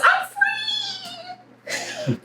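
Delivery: high, gleeful cry of freedom; excited cry. Acted. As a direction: squeal it out, thrilled to escape. Acted fly escaping the web.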